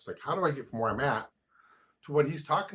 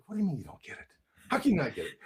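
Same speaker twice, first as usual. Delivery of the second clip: incredulous disbelief; 5 semitones higher, faster than usual. Retold or imitated. Husband crushed wife doesn't get book.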